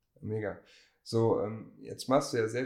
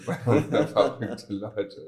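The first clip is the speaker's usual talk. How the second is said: louder, laughing through the words; laughing mock protest; 8 dB louder, slower than usual. Host imitates listeners from the Pott, laughing mock protest.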